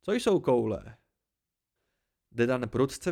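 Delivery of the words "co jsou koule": innocent, puzzled question; curious. A narrator's reading. Tempi asking the others about an unknown word, curious. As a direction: ask what the strange word means. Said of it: "opakoval zvědavě".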